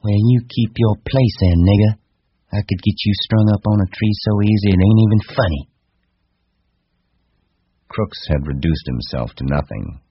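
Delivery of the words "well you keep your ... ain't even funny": high, loud woman putting him down; loud, scornful female character; menacing wife. Acted. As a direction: threaten him with raised pitch and force.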